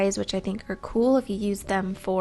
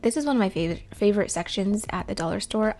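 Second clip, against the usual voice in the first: ordinary talk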